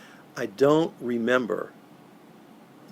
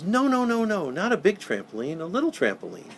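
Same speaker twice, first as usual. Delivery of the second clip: high, eager child's voice imitated; voicing a little boy; 8 semitones higher than usual. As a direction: play the small boy correcting Santa, pitched up. Santa voices a small boy.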